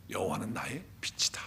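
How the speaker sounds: a secret held close, whispered; whispered declaration. Acted. Preacher whispers the verse to the church, in a whisper.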